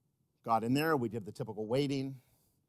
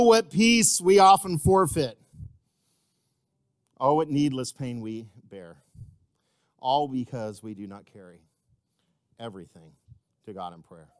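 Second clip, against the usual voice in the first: hymn recited, unsung; 10 dB louder than usual. Real, not acted. Preacher leading the church through a hymn, dramatic spoken recitation.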